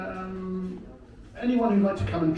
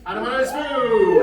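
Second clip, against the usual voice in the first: drawn-out announcer call; 9 semitones higher, much slower than usual. Acted. Host introduces the last contestant with a slow showy shout. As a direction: call out the name like a ring announcer.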